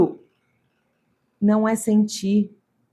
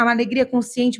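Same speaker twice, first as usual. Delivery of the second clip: ordinary talk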